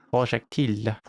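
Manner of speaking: put-on lightly French-accented word; French pronunciation. Streamer joking about a game word, mock French accent. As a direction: say "projectile" as if in French. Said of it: "as they say in France".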